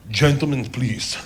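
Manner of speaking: low, measured commanding woman; calmly quiet a rowdy room in character; German dominatrix. Retold or imitated. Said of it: "German woman, six feet tall".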